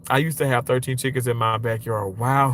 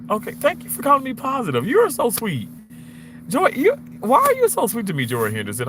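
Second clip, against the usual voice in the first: much higher, sugary fake gratitude; fake-sweet thank-you to an insult; mock flattered; 10 semitones higher than usual. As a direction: gush sarcastically, way up high. Called POS, mock thanks.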